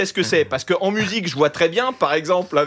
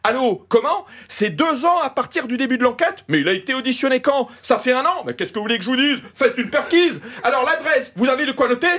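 overheard lawyer talking on the phone, pitched higher; 6 semitones higher than usual. Retold or imitated. Comic retells a lawyer's call overheard at the gate, in a hammed-up higher voice. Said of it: "je l'ai appelé l'avocat problématique".

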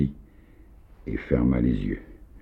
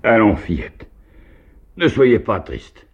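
much higher, louder cry; exclaimed; 11 semitones higher, 5 dB louder than usual. A narrator's reading. Host calls out to the sad girl, exclaiming loudly. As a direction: call out brightly to cheer her. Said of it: "il s'écria".

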